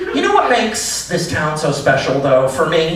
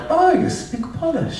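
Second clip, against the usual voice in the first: put-on impressed girlfriend; 14 semitones higher, 4 dB quieter than usual. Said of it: "she'll be like".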